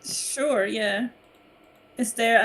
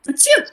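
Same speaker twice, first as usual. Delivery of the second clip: high, loud acted sneeze; fake sneeze; 8 dB louder than usual. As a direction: sneeze it out big on purpose. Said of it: "I sneeze".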